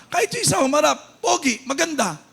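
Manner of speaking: preacher fired up telling everyone they are beautiful; full-force preaching. Acted. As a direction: lift the congregation, punch each line.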